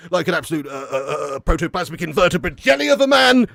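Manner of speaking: put-on bumbling posh Eton boy, full of uhs; Boris impression. Shown as a demonstration. Mimicking Boris for the viewers, bumbling posh voice. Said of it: "That was more Boris".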